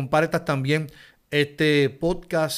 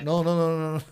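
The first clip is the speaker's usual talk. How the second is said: usual speaking voice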